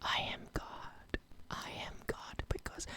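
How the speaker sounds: mock ASMR reading; ASMR whisper. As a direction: breathe it out like an ASMR video. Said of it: "just an A S M R one".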